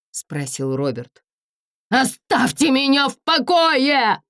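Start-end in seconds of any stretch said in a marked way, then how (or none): 1.9-4.2 high, slow desperate shout. Acted. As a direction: scream it, cornered. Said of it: "закричал Форест".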